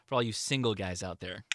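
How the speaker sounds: ordinary talk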